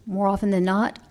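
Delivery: normal talking voice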